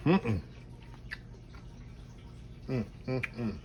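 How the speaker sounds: low contented mm-mm while chewing; delighted tasting hums; savoring hum with mouth full. Real, not acted. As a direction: hum with pleasure over the food. Tasting the food.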